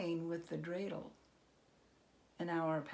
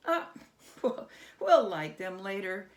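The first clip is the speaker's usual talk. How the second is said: laughing dismissal; 6 dB louder than usual. Acted. Herschel laughs off candles.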